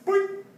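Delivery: high, springy boing; boing sound effect. Pretend. Teacher putting toy parts together, playful boing. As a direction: pop it out like a spring.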